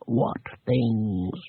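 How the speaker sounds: a dry little voice at the back of the brain; tiny dry voice. A narrator's reading. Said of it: "A tiny, dry voice".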